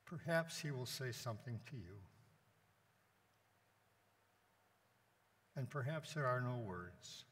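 measured meditation with long silences; guided meditation. Acted. Priest guiding the congregation to be with Jesus, meditative. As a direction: invite them to rest in the moment, leaving space between phrases.

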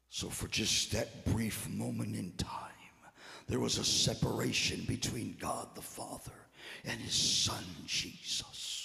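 low, soft, halting sermon; hushed solemn preaching. Real, not acted. Preacher tells congregation of the cross, hushed and grave. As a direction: drop to a near whisper, long pauses.

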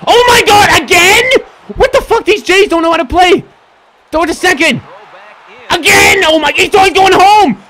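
loud disbelieving fury at the team; exasperated yelling. Real, not acted. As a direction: rant at the clumsy fielders at full volume. Raging at his fielders.

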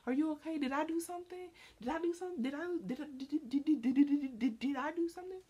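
high, fast, stammering on did; mock panicked asking. Imitating herself begging a moody friend, high and panicky. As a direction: spiral into anxious repeating. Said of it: "I asked it so many times".

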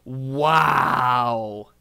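loud, drawn-out wow; amazed shout. Real, not acted. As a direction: belt it out in astonishment. Amazed reaction to a clip.

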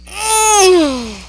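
loud high groan, falling; high moan sliding down in pain. Real, not acted. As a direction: groan long as if hurt. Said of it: "That hurt".

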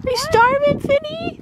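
very high squeaky pet voice. Real, not acted. Baby-talking to the horse.

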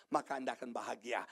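usual speaking voice

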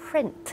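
print with clear R and released T; English print, every consonant heard; final T sounded. Shown as a demonstration. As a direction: hit the T at the end of print. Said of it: "ใส่ T".

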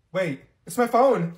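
normal talking voice

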